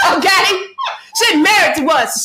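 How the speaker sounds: shrieking with laughter; laughing shout. Real, not acted. Laughing loudly while joking to her cohost. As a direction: laugh it out loud and high.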